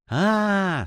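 high, drawn-out "ah" of relief; monk's weight-off-the-shoulders exclamation; relieved. A narrator's reading. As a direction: let out a lifted, relieved "ah". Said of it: "глубоко вздохнув, будто с него свалилась большая тяжесть".

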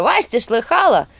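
high, loud rattling skull; mock her like a clattering skull; cackling skull voice. A narrator's reading. Skull mocks.

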